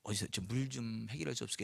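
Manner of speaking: soft, low, mild asking; gentle request. Shown as a demonstration. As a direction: ask gently, keeping the voice well down. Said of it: "이게 부드럽게 얘기하는 거하고 좀 다르죠".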